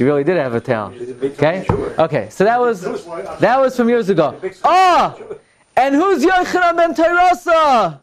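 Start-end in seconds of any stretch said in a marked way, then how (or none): 4.6-5.2 sudden high delighted shout; excited cry. Real, not acted. Bursts out at the punchline. 5.8-8.0 laughing shout. Real, not acted.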